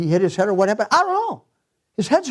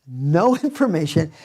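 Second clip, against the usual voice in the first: "No information" repeated with a real laugh breaking into it. Real, not acted. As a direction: chuckle right through "No information". Pastor repeats line laughing.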